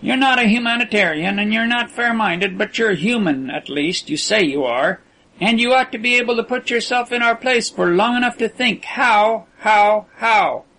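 lightly voiced tall aviator, urgent; tall prisoner. A narrator's reading. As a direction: press the captor to feel for them.

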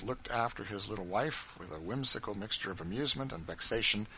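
ordinary delivery

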